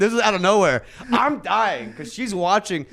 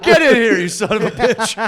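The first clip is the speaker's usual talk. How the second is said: louder, higher playful yell; mock shout; 6 semitones higher, 8 dB louder than usual. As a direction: holler it like calling someone over. Shouting at snowman joke.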